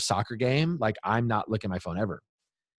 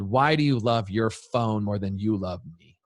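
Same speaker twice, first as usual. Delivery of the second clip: normal talking voice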